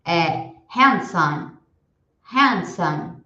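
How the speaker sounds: each word said slowly and clearly, then repeated; vocabulary drill for students to repeat; repeat-after-me drill. Shown as a demonstration. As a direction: model every word for the class to echo. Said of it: "Eu vou falar e vocês repetem em casa".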